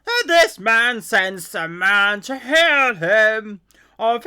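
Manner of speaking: loud, slow, booming royal outrage; a king roaring in disbelief, dragging out every word; outraged king. Acted. The king of Israel, having torn his clothes, protests to his court in a loud, slow, outraged boom.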